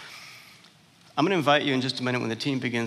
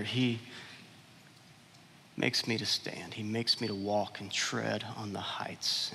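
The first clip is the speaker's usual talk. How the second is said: hushed reading; 5 semitones lower, 10 dB quieter, slower than usual. Acted. Scripture read hushed.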